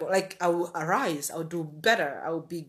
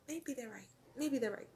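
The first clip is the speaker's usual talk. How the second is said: defeated murmur; 4 semitones higher, 12 dB quieter than usual. Retold or imitated. Voicing giving in to insults.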